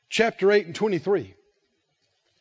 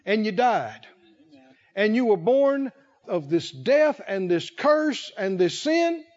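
ordinary talk

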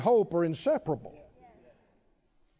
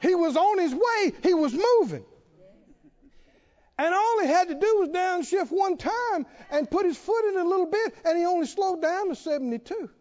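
high-pitched, driving sermon delivery; fervent preaching; 10 semitones higher than usual. Real, not acted. Preacher exults in Brother Vic's joy, fervent high preaching.

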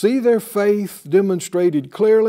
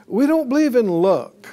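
ordinary talk

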